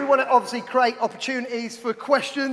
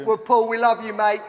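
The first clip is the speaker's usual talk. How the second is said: ordinary talk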